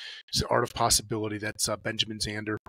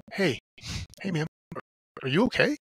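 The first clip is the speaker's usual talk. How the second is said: concerned check-in; 6 semitones higher than usual. Retold or imitated. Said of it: "I chose the middle finger".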